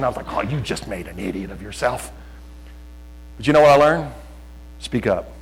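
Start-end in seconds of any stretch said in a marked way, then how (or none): 0.3-2.1 low, soft aside to oneself; muted self-reproach. Retold or imitated. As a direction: retell a sinking inner thought quietly. Retold self-reproach after nervous speech.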